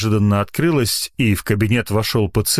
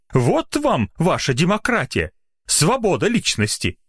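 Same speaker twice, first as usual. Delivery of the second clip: high-pitched indignant complaint; exasperated rant; 9 semitones higher than usual. A narrator's reading. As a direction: vent outrage, pitch raised throughout. Doctor ranting to nurse, exasperated.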